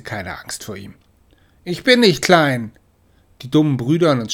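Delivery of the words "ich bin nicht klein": higher, louder and slow insistence; defiant protest. Acted. Smallest kitten insisting to her siblings, loud and slow. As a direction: stamp your paw down.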